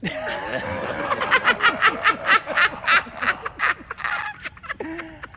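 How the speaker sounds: shouted mocking laugh. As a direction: throw your head back and roar at him. Bandit mocks the Count's demand.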